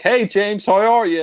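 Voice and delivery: high, breezy mock-cheerful greeting; high mock-jovial friend voice; mock jovial Felix. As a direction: ham up a too-cheerful Felix on the phone. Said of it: "he's like".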